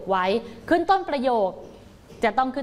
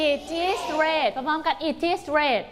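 It is red drawn out very slowly; read together slowly; 4 semitones higher, much slower than usual. Shown as a demonstration. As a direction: lead the class in chorus, slowly. (อ่านพร้อมกัน It is red ช้าๆ)

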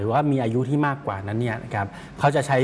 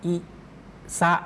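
"อะ อิ ซา สึ" one syllable at a time, with pauses; slow and clear; 4 semitones higher, 4 dB quieter than usual. Shown as a demonstration. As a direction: sound out each syllable of "อะ อิ ซา สึ" separately. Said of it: "ถ้าออกเสียงช้าๆ ชัดๆ". (ออกเสียง "อะ อิ ซา สึ" ช้าๆ ชัดๆ ทีละพยางค์)

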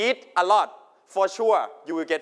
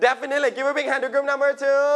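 celebratory announcement; 8 semitones higher than usual. Real, not acted. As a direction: call for applause in a raised game-show pitch. Cheering a group's correct answer. (ประกาศชมเสียงสูงอย่างตื่นเต้น)

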